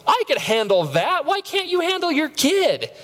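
high, slow incredulous scoff; smug scorn. Retold or imitated. Smug dad judging other parents, slow high scoff. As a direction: drag it out in disbelief.

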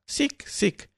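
tiny high squeaking rat cries; sharp high-pitched rat squeak. A narrator's reading. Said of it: "dando gritos de rata".

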